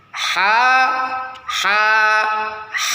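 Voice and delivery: H pushed from a narrowed mid throat; letter ḥa demo. Shown as a demonstration. Teacher demonstrating the throat letter ḥa with a narrowed breathy H. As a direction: breathe the H through a tightened middle of the throat. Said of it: "kalau pengucapannya gini".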